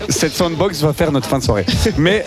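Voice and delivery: ordinary delivery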